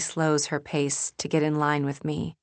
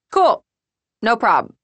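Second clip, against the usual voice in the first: much higher and louder, breezy brush-off; breezy Molly; 7 semitones higher, 9 dB louder than usual. Acted. Molly brushing off Julie's return, breezy and high. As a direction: toss it off lightly.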